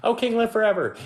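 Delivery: high, chipper royal greeting; cheery reply. Retold or imitated. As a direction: answer brightly from the pit as if nothing happened. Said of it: "Daniel's like".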